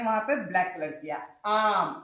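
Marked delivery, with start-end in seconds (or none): 1.4-2.0 आम with a drawn-out open आ; long open आ in आम; open-mouthed aa. Shown as a demonstration. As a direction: say आम with the mouth wide on आ. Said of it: "मुंह खोलोगे आ".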